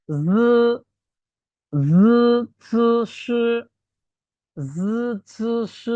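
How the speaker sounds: জ, চ, শ as long buzzing, hissing syllables; tongue against teeth. Shown as a demonstration. Teacher showing three characters, sounds made against teeth. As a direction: press to the teeth and hold জ, চ, শ. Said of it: "দাঁতের সাথে লেগে উচ্চারণ হবে".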